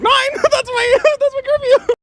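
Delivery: high, laughing at the idea; shocked laughing disbelief. Retold or imitated. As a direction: hear the time and crack up. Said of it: "I was like".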